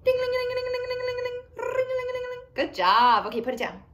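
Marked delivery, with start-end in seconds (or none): 0.0-2.4 high, loud ringing ding-ling chant; bell sound. Shown as a demonstration. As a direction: ring it out like a little bell. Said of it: "Can you ring your listen up bell?".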